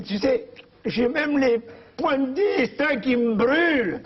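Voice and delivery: vieille dame toute émue, voix haute et lente; fais-la fondre d'émotion, lentement; high, slow swooning old wife. Retold or imitated. (la vieille parle à Léon, voix haute, lente et pâmée)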